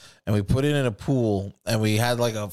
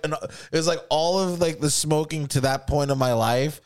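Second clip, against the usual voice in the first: ordinary talk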